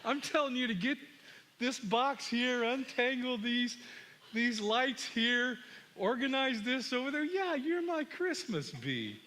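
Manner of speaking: high-pitched, deliberate fatherly orders; retold insistent comeback. Father orders defiant son to untangle lights, insistent. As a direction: insist point by point, pitch held up. Father answers son's angry outburst.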